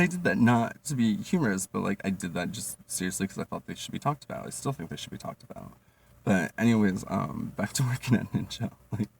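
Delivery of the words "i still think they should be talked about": half-whispered, much softer; a soft whispered afterthought; quiet aside. Real, not acted. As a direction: mutter it under the breath.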